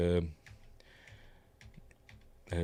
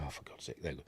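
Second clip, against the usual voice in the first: whispered exasperation; 7 semitones lower, 20 dB quieter, much faster than usual. Real, not acted. Annoyed muttering after the sneeze.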